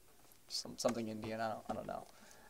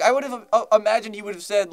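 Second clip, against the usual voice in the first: ordinary delivery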